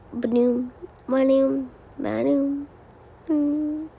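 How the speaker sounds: usual speaking voice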